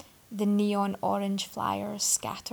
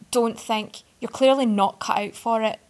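louder, cutting sneer; acid interruption; 8 dB louder than usual. A narrator's reading. Arthur cuts off the boy with acid scorn. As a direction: cut him down with acid. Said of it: "Arthur interrupted acidly".

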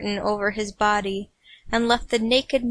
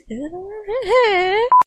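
giggling fit; high laugh; 10 semitones higher, 3 dB louder than usual. Real, not acted. Reader laughing at her weak Arthur shout.

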